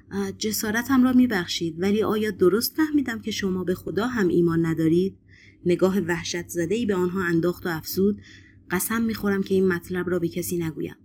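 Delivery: ordinary delivery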